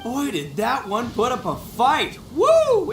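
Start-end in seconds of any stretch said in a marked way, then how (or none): 2.3-2.9 high, loud celebratory whoop; big happy woo. Acted. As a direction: let out a cheer. Cheering the beetle win.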